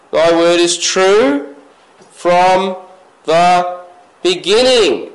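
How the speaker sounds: "from", "the", "beginning" each set apart by pauses; slow, deliberate word by word; weighty pauses between words; spaced-out emphasis. Shown as a demonstration. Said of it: "words spaced out for emphasis".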